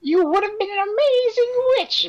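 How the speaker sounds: high theatrical witch voice; a high-pitched scheming witch; Edna voice. Acted. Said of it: "This should be like an Edna voice".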